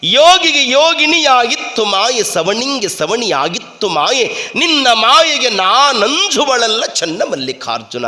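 ringing, declaimed devotional verse; verse recitation. Acted.

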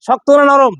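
ordinary talk

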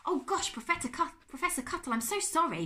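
high, loud, stumbling apology; flustered exclamation. A narrator's reading. Bertolt apologises to trapped professor, flustered exclamation. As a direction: blurt it out in dismay. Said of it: "Bertolt exclaimed".

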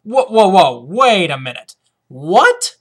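loud, incredulous double take; mock disbelief. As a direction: halt the reading, stunned and loud. Breaks off reading in mock disbelief.

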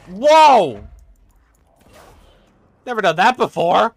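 loud high amazed shout; excited shout. Real, not acted. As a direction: cry out in delighted surprise. Surprise success in game.

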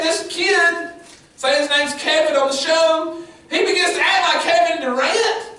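high, loud preaching climb; preaching at full force. Acted. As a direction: preach it out, building up.

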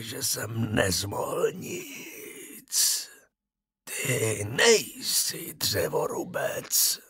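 slow, soft, breathy whisper from the grave; a dead man calling his son, slow and eerie; ghost of the dead father. A narrator's reading. Ghost speaks. (pomalý šeptavý hlas ducha mrtvého otce)

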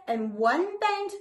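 ordinary delivery, nothing marked

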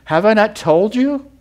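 high, loud and insistent, hammering the question; rising preacher's shout. Acted. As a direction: build it into a pulpit cry. Preacher repeats God's question louder.

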